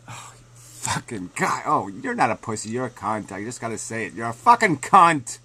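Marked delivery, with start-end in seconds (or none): 0.1-1.6 whispered insult. Real, not acted. Muttered insult. 4.1-5.4 shouted insult. Real, not acted.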